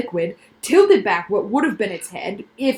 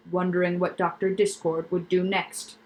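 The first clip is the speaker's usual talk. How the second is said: usual speaking voice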